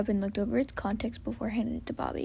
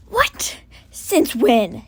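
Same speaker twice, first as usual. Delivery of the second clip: shocked exclaim; 13 dB louder, much slower than usual. Acted. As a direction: blurt out disbelief. Chim and Hen exclaim in shock.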